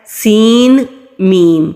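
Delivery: سین میم said plainly with نون fully pronounced; wrong way. Shown as a demonstration. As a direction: show the mistake in plain speech. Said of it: "یہ غلط طریقہ ہے". (غلط طریقہ، سین میں نون پڑھنا)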